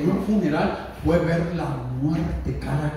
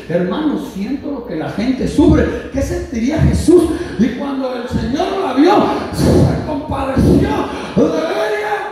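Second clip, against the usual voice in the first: raised, high preaching voice; impassioned preaching; 7 semitones higher, 5 dB louder than usual. Acted. Preaches at full force. (predicación apasionada, con la voz en alto)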